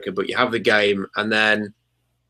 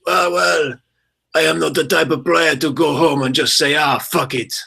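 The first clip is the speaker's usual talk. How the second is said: imitated Slovakian accent, pitched higher; Bond villain accent; 5 semitones higher than usual. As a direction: quote the player in a thick Bond-villain accent. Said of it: "fantastic Bond villain style accent".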